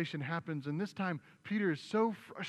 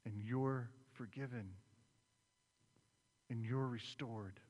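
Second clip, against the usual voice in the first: tender forgiveness; 2 semitones lower, 6 dB quieter than usual. Retold or imitated. Jesus forgiving Peter.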